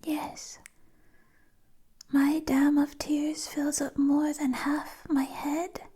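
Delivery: ordinary talk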